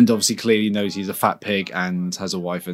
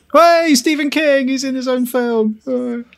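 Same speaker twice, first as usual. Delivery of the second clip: a much higher, slower call of surprised delight; excited spotting; 14 semitones higher than usual. Real, not acted. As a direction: point him out like a thrilled fan. Spotting Stephen King on screen.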